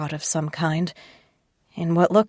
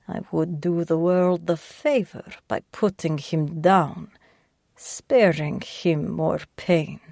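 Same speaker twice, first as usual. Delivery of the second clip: put-on slow, heavy Russian accent, patronising and sly; an old Russian gangster soothing his captive, slow and thick-accented; Russian captor, thick accent; slower than usual. Volkov warns her about Sasha.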